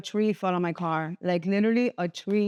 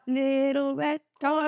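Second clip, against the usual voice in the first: much higher, slow, sweet gushing; cutesy delight; 5 semitones higher, slower than usual. Retold or imitated. Picking her new car, cooing high and slow. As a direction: coo it like a cute toy. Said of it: "'Cause I thought".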